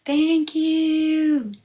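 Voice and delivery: a high, loud mock-sweet "thank you"; pointed thank you. To an angry person, a loud, pointedly cheerful thanks. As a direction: thank them brightly to shut down their anger. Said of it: "I just told that person".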